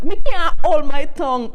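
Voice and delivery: suddenly loud, bursting out; emphatic outburst. Real, not acted. Bursting out about speaking patois.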